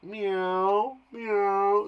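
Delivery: drawn-out mewing meow; stretched, imitated cat call; little cat. Shown as a demonstration. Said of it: "Like a little cat".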